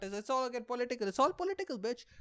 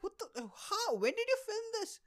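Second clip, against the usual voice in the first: stunned disbelief. Retold or imitated. Shocked at seeing friend's ad.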